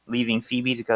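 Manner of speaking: normal talking voice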